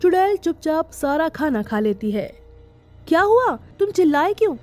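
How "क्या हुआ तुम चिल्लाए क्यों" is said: alarmed. Acted. Wife finds husband screaming.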